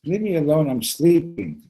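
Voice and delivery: high, loud mock complaint; annoyed sleeper. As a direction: protest at being woken, hammed up. Sleeping friend refuses, storytelling.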